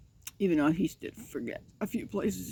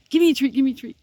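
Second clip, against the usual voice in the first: lightly voiced eager puppy, higher and louder; voicing the puppy; 6 semitones higher, 7 dB louder, much faster than usual. Owner speaking for her puppy begging a treat, lightly voiced eager puppy. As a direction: beg like the dog would. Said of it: "she says".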